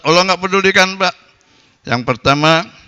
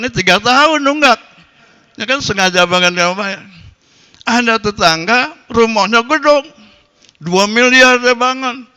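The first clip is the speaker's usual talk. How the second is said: indignant preaching; 7 semitones higher than usual. Acted. Preacher indignant at bad neighbours.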